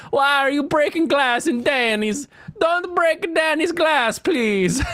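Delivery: high, pleading cartoon Italian; mock Italian. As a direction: plead in a squeaky, hammed-up Italian accent. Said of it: "If you're Italian, you say it like this".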